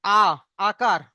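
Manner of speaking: আ drawn out clearly as a long a; teacher modelling the vowel আ; long a sound. Shown as a demonstration. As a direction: sound আ then say আকার, clear and open. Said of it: "জাবারের উচ্চারণ হবে".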